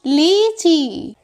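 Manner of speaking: लीची with the ई held long and slow; drawn-out long ई. Shown as a demonstration. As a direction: stretch the ई in लीची slowly. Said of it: "इन दोनों का उच्चारण कैसे करना होता है".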